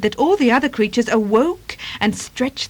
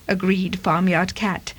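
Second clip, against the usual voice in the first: plain reading voice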